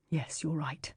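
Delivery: a breathy hushed whisper; urgent whisper. A narrator's reading. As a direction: whisper it so nobody hears. Tense reply in hushed scene.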